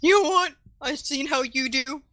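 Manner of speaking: street hustler giving grudging respect; ticket scalper impression. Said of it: "my impression of a scalper".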